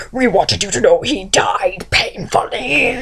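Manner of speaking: slow, whispery creepy monster; drawn-out villain rasp; Mouth of Sauron. Acted. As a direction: relish the taunt in a gross creature voice. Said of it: "the Mouth of Sauron goes".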